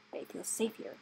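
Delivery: hushed whisper of "safe here". A narrator's reading. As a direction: breathe "They feel safe here" unvoiced. Rat mocking their safety.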